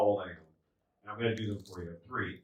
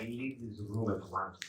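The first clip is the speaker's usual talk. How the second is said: slow, softer lordly command; imperious dad; 3 semitones lower, 13 dB quieter, slower than usual. Retold or imitated. Dad dismisses his child, slow mock-grand impression. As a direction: lightly imitate the dad's stately speech. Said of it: "That's how he talks".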